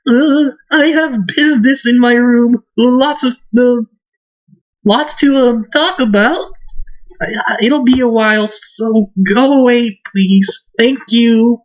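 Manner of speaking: high-pitched, halting excuse-making; nervous recluse girl. Acted. Bernadetta shoos visitors from her room, nervous high voice. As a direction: stammer polite excuses in a high girl's voice.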